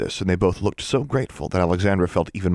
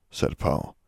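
plain reading voice

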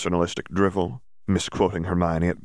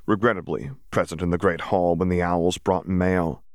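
ordinary delivery, nothing marked

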